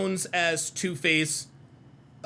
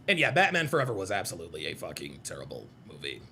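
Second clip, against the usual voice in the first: ordinary talk, nothing marked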